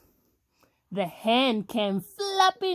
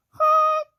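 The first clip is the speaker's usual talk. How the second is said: high, loud cried honk; scared honk; 7 dB louder than usual. A narrator's reading. Stuck dump truck calls for help, scared honk. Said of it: "cried the dump, and he sounded scared".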